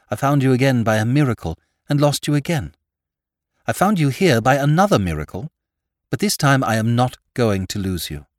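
impassioned declaration. A narrator's reading. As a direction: declare love with mounting determination. Impassioned declaration of love in story.

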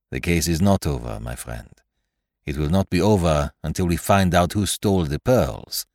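measured French detective, firmly correcting; French-accented detective. Acted. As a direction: insist calmly with a French accent. Poirot's dialogue in narration.

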